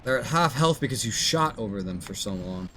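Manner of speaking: usual speaking voice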